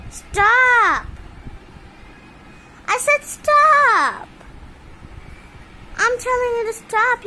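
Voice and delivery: high, loud, drawn-out yell of stop; shouted stop. Real, not acted. Owner yelling at her pet bird to stop, loud.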